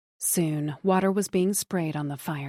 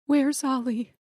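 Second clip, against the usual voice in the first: pleading; 7 semitones higher than usual. A narrator's reading. Wife pleading with police.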